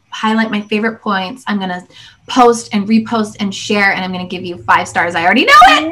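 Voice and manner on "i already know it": excited. Real, not acted. Promising five stars, excited.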